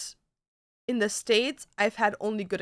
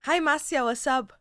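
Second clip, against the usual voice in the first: usual speaking voice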